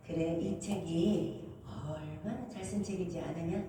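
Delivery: Jesus answering. Retold or imitated. Jesus voiced in testimony.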